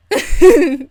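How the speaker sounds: loud cackle at the punchline; loud high cackle; laughing at own joke. Real, not acted. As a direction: crack up at your own joke.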